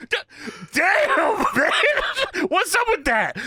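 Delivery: high-pitched comic dismay, breaking into laughter; exaggerated high exclamation of revulsion; mock disgust. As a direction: complain like an imitated wrestler hit by a stink. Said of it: "Booker has a disgusted look".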